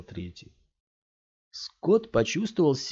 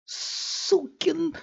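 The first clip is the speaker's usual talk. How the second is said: very high, strangled yell of rage; furious cry. A narrator's reading. Scott screams in rage at the thug, spitting blood. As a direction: explode at him. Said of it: "закричал от злобы Скотт".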